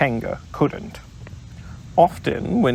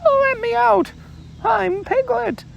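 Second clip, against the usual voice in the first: much higher, desperate squeak; crying out Piglet. Acted. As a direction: plead high to be let out. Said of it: "cried Piglet".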